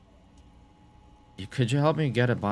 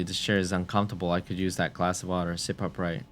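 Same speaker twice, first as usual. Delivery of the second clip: ordinary talk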